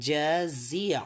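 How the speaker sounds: জাজিয়া with j first, then a buzzing z; careful correct pronunciation. Shown as a demonstration. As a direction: stretch out জাজিয়া as ja-zi-a. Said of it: "এটা উচ্চারণটা অনেকেই পারছিলাম না".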